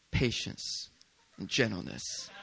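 soft breathy whisper of the words; whispered virtues. Shown as a demonstration. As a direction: let the words fall into a hush. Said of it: "repeats virtues, softer".

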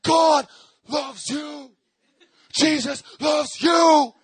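slow, booming shout of a street preacher; imitating a preacher yelling. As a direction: bellow it out, slow and huge.

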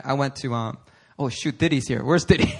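normal talking voice

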